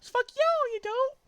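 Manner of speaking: put-on very high, loud squeaky girl shriek; Amy voice. Amy screeching at Sonic as she swings her hammer. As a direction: screech it like a furious cartoon girl. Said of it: "says Amy".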